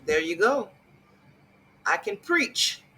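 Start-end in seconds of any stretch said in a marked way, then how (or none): 1.8-2.8 mock preacher boast.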